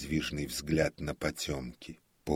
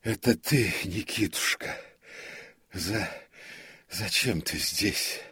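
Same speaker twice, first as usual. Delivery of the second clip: higher, halting, stammered question; shaken disbelief; 5 semitones higher, 4 dB louder than usual. A narrator's reading. Comic recognises the prompter after fright, halting. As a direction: stumble over the words, still shaken.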